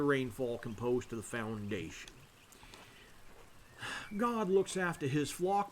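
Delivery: drawling Southern priest from Georgia; deep Georgian accent. Acted. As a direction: play a Father with a heavy Georgia accent. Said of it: "the deep Georgian accent".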